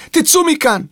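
high, urgent shooing; pressing command; urgent order. A narrator's reading. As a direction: shoo them out of here. Maid orders children out.

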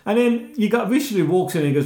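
ordinary talk, nothing marked

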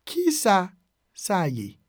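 "Ki sa", pause, then "sa ye"; broken into parts. Shown as a demonstration. Teacher modelling a Creole phrase for learners, broken into parts. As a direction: split it in two for learners.

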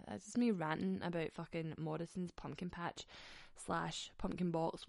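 ordinary talk, nothing marked

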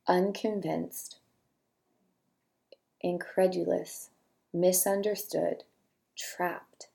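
quiet, unhurried reading of single words with long pauses; soft list reading. Acted. Host reading feeling words aloud for listeners to note, soft and slow. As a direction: let each word land softly, one at a time.

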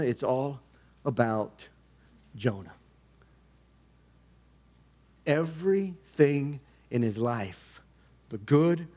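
hushed close preaching. Acted. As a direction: ask it gently, like holding up a mirror. Quiet reflective application.

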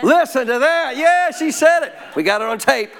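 high, jubilant gloating; triumphant crowing. Real, not acted. Husband after wife said yes, playfully triumphant. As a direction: crow it as if you just won.

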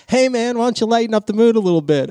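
high, loud imitated heckle; retold shout, pitched high; mock yell up. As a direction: call up to someone on a roof. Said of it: "somebody will yell up".

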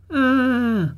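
high, drawn-out cry; high contained scream; falling cry. A narrator's reading. Remembered fall from the cliff.

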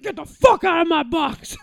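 angry umpire. Retold or imitated. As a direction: bark the catcher away like an irate ump. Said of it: "And then he's like".

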